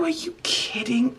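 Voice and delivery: high, breathless whisper of amazement; whispered disbelief. Real, not acted. Vlogger stunned by her crayon art, high whispered disbelief. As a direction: barely breathe it out.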